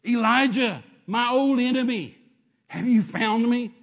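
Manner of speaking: loud, drawn-out mock dread; hammed-up scared king. King Ahab met by the prophet he fears, hammed-up fearful cry. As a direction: cry out like a cornered king, theatrically. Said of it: "It's the king scared of the prophet".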